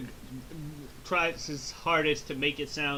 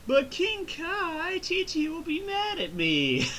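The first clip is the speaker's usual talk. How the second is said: much higher, slow, put-on whine; whiny Goku; slower than usual. Goku worrying about his wife, slow high whine. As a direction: drag it out like a worried kid. Goku whining to King Kai.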